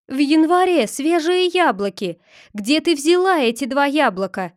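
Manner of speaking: high, loud astonished questioning; изумлённый. A narrator's reading. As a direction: exclaim in amazement, then demand an answer. Злобога изумлена яблоками в январе. (изумлённо, повысив голос)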